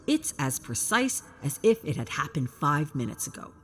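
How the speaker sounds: ordinary delivery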